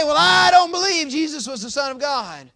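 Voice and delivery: high, loud preacher's quote of an unbeliever; loud mock doubter. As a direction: throw out the objection at preaching volume. Voices a doubter, still preaching loudly.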